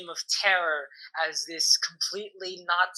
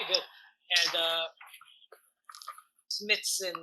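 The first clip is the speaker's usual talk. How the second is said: ordinary talk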